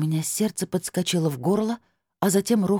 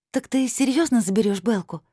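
put-on higher, excited girl's question; восторженная девочка; 8 semitones higher than usual. A narrator's reading. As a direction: ask with delighted hope, pitched up. Child excitedly asks aunt. (радостно и удивлённо, высоким голосом)

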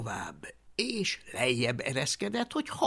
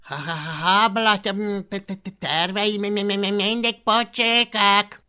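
high, loud stammering jeer; stuttering dragon; 7 semitones higher, 10 dB louder than usual. Acted. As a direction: stammer through it like a teasing creature. Stuttering dragon mocks Hablaty's plans.

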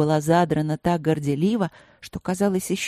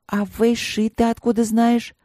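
higher, eager questioning voice; curious princess; 6 semitones higher, 4 dB louder than usual. A narrator's reading. Princess questions the tiger about Вэйши, curious. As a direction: ask it high and curious.

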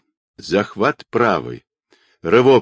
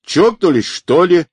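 high, raised driver's outburst; loud, higher-pitched exclamation; yelling; 8 semitones higher, 6 dB louder than usual. A narrator's reading. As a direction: yell it in startled indignation. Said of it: "и тут же заорал".

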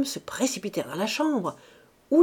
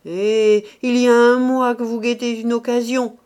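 loud, angry outburst; exasperated shout; 4 semitones higher, 9 dB louder than usual. A narrator's reading. Rose accusing Mouret, exasperated shout. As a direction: shout it at him, fed up. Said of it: "cria Rose exaspérée".

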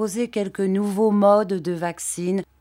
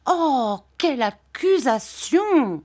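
higher, slow wide-eyed exclamation; shocked cry; 7 semitones higher, slower than usual. Acted. Dora, shocked by her husband's reproach, higher and slow. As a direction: gasp it out in disbelief. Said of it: "s'écria Dora en écarquillant les yeux".

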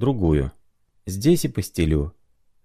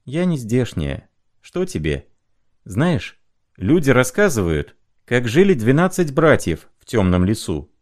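put-on higher, storytelling girl's voice; lightly voiced young woman; 6 semitones higher than usual. A narrator's reading. Young woman telling the sick man a folk tale, lightly voiced.

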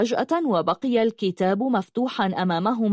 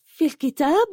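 high-pitched astonished question; gaping astonishment; 7 semitones higher than usual. A narrator's reading. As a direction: ask it open-mouthed, pitch jumping up. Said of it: "فغرت فها وقالت". (باندهاش شديد)

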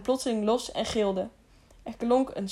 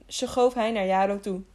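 usual speaking voice

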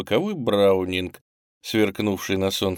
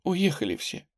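high, soft tearful voice; sobbing; 8 semitones higher, 3 dB quieter than usual. A narrator's reading. Girl weeping that father has left, sobbing. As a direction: whimper it like a child crying. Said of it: "всхлипывала она".

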